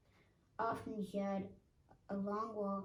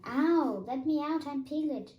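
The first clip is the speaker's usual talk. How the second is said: high, loud yelp of protest; protesting yell; 6 semitones higher, 10 dB louder than usual. A narrator's reading. Piglet being scrubbed in the bath, yelling high and loud. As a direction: yell to be let out.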